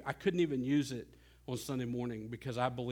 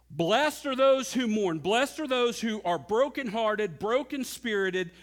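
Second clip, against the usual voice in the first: higher and louder scripture proclaimed; full-force preaching; 6 semitones higher, 5 dB louder, slower than usual. Acted. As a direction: proclaim the verse to the whole room.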